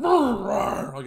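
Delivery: loud, drawn-out dog growl impression; imitated snarling dog. A wolf of a dog going at him, imitated snarl. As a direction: growl like a huge aggressive dog.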